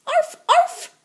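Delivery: high yappy little arf arf; barking. Acted. Little sister cat pretending to be a chihuahua, yapping high. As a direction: yap it out like a tiny dog. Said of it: "barked Jujubee".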